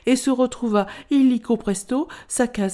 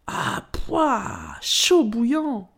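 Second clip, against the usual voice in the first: drawn-out, higher exclamation of disgust; disgusted cry; 4 semitones higher, much slower than usual. A narrator's reading. Narrator plays Bou tasting soup that is too hot, disgusted. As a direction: recoil from scalding soup, stretching the words.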